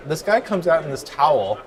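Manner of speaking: ordinary talk, nothing marked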